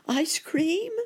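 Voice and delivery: very high, loud hopeful squeak; hopeful surprise. A narrator's reading. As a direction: ask it with sudden hope. Hopeful rabbit question.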